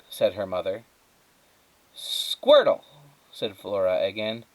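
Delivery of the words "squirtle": high, louder emphatic read; louder reread. Acted. Flora reading the typed word again, emphatic. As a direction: read the odd word out boldly.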